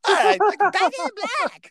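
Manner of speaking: high, loud, stretched-out shout; mock rock shout. Dad hyping his baby son with a loud rock call. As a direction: belt it like cranking up a rock song. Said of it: "I was like".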